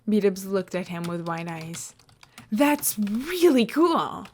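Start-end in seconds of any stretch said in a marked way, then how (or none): 2.5-4.3 higher, louder and slow, full of wonder; excited. Acted. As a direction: gush like you're amazed. Wide-eyed Beatrice impressed.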